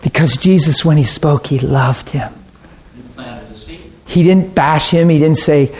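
ordinary talk

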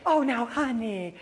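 high, slow mommy voice; hammed-up doting mother. Mother turns back to toddler, mock-sweet chiding.

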